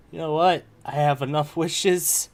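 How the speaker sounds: acted-out sudden decision. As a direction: replay the moment, ending on a loud burst. Retelling own impulsive wish decision.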